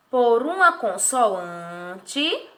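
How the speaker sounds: very slow, "consoante" stretched and rising; drawn-out answer. Shown as a demonstration. Teacher explaining the syllable cui, "consoante" drawn out. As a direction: hold the middle of "consoante".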